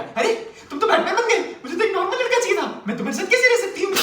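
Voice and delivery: very high-pitched, exaggerated female whine; mock outraged girlfriend. Girlfriend rejecting her Batman boyfriend, high mock outrage. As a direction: mimic her in a shrieky falsetto protest. Mimics woman complaining at Batman.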